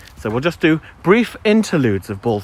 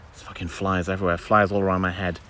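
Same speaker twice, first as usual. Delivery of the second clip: ordinary talk, nothing marked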